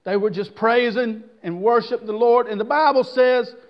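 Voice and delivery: ordinary delivery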